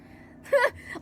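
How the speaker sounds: sudden high squealing laugh; real squeal of laughter; high giggle. Real, not acted. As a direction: burst into a high delighted giggle. Delighted by her twin's words.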